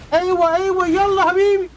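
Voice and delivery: high, loud mock parent yell in Arabic. Mimicking his yelling parents, a high loud mock shout. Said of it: "my mom and dad were yelling".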